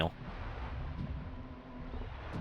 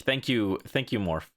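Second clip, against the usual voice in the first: normal talking voice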